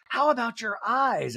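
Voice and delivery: high, slow mimicking voice; mimicked housewife. Host mimicking a housewife's talking-head jab, high put-on voice. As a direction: imitate her high-pitched, drawn out.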